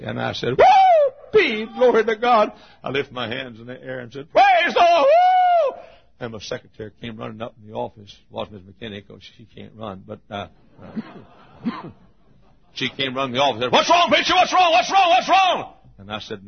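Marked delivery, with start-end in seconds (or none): 0.6-2.5 high, loud shout of praise; happy whoop. Retold or imitated. Preacher rejoicing over a Bible discovery, happy shout. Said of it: "and I got happy". 4.3-5.8 very high, loud whoop; happy praise shout. Retold or imitated. Preacher lifting hands alone in the auditorium, joyful shout. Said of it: "and I got happy". 13.7-15.7 high, loud rapid-fire panic, hammed up; alarmed shouting. Secretary running into the preacher's office, alarmed mock shout.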